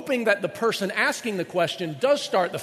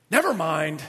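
weary never mind; 2 semitones lower, 4 dB louder than usual. Retold or imitated. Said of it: "this one I've thought about".

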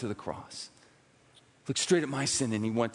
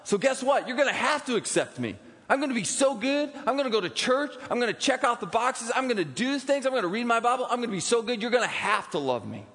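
high, eager people-pleaser voice, mock-earnest; self-righteous boast; 9 semitones higher than usual. Preacher mocking a believer bargaining with God, eager and high. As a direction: play the smug do-gooder bargaining with God.

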